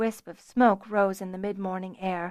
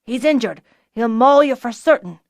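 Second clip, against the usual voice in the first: urgent shout; 3 semitones higher, 8 dB louder than usual. A narrator's reading. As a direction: call out the danger. Archer warning loudly.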